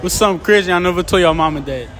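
ordinary talk

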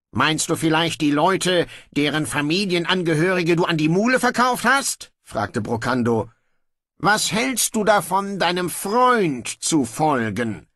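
a lazy king giving in; offhand young king. Acted. Brocando grudgingly orders guards to release his brother, breezy.

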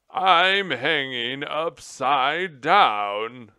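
loud, high, drawn-out angry shout; furious. A narrator's reading. Stuck general to his rescuer, furious shouting. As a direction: bellow every word slowly in indignation. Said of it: "the general said furiously".

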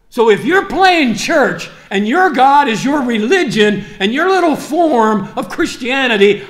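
loud, high, fervent sermon voice; preaching at full force to the congregation; fiery preaching. Acted. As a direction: drive it home to the church.

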